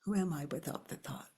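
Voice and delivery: hushed breathy inquiry; whispered question. Shown as a demonstration. Teacher guiding the inquiry, whispered softly. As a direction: whisper it to yourself. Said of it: "inquiry question whispered".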